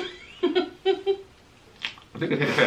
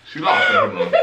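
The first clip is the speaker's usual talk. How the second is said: gleeful; 6 dB louder than usual. Real, not acted. Gleeful gloating, far higher.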